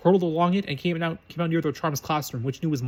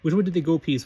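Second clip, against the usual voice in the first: ordinary delivery, nothing marked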